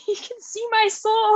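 breaking up laughing mid-quote. Real, not acted. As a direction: quote squeaked out high through laughter. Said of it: "I was laughing so hard".

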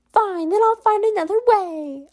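high-pitched, huffy threat; Ruby. Acted. Rejected caller vowing another way, high-pitched and huffy. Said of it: "I love Ruby's voice here".

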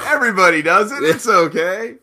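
lightly mimicked self-excuse; retold quote. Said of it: "and said".

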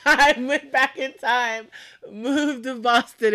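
loud, high, drawn-out echo; mock outrage. Host echoing co-host's voter complaint, hammed-up grievance. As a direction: laughingly imitate an aggrieved voter.

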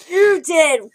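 very high, drawn-out shocked shriek; mock scream. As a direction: scream in disbelief from inside the house. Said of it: "we just hear him scream".

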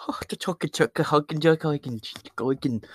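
a lightly voiced mock Irish-Scottish gibberish accent. Podcaster mocking Irish and Scottish listeners, in a mild mock Irish accent. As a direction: mumble "huckin' chucka" like a bad Irish impression. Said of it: "That's what you guys all sound like to me".

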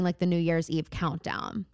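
ordinary talk

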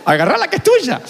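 high, hammed-up taunt; mock retort. The preacher joking as the rich man, high teasing taunt. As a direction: toss it back teasingly.

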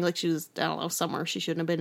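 usual speaking voice

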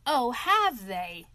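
slow, loud, drawn-out challenge; indignant. A narrator's reading. Toad hearing of squatters in his home, indignant. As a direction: lean on it with rising defiance. Toad reacts defiantly, seizing a stick.

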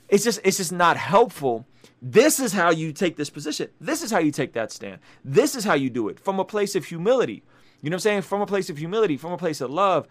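usual speaking voice

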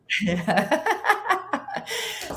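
a high, bright burst of laughter; real laugh. Real, not acted. Laughing at the finish time of her own ride.

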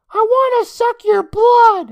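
loud, high squealed vampire threat; hammed-up high boyish spook; kid playing vampire. A narrator's reading. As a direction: jump out squeaking a drawn-out mock threat. Boy jumps out imitating a vampire.

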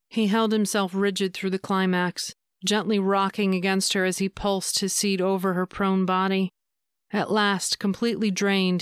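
ordinary delivery, nothing marked

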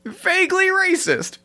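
wry. Real, not acted. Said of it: "which is exciting".

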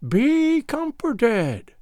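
very high, very slow mocking crone voice; hateful mockery. Gagool mocking the girl before her death, high hateful crone voice. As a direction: drawl false comfort like a cruel witch. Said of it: "in her hateful tone of mockery".

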